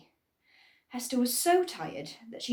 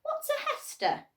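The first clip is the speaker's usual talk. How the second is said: very high little critter voice; squeaky creature voice. Acted. Hidden bush creature questions Hester in a squeaky high voice. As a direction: pipe up like a tiny hidden creature. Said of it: "Another voice answered".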